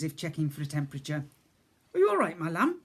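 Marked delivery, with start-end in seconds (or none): 1.9-2.8 higher, gentle fussing voice; motherly cook. Acted. Cook checks the boy's temperature, gently. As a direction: soothe him like a gran.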